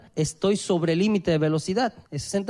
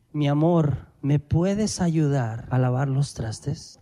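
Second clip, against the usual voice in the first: gentle request; 6 semitones lower, slower than usual. Retold or imitated. Wife Alba asks him to wash dishes, gentle slow request. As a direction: ask it kindly and slowly. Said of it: "Alba hizo magníficamente bien".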